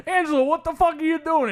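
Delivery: high, mock-exasperated mobster voice; exasperated boss. Fed-up boss scolding Angelo, high mock exasperation.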